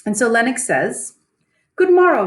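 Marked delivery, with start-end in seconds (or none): 1.8-2.3 hearty greeting. Acted. Teacher voicing Lennox's greeting.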